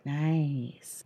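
low, soft, impressed "nice"; deadpan approval. Retold or imitated. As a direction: mumble it like an unbothered dude. Dudes reacting to implants.

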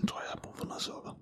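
fast, weak whisper of someone fading; faint whisper. Acted. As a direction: breathe it out as if fainting. Faint from blood loss.

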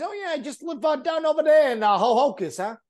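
high-pitched mock New Jersey accent; imitating local New Jersey accent. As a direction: put on a New Jersey local bragging to friends. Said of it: "'Cause that's how they talk".

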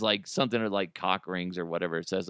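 usual speaking voice

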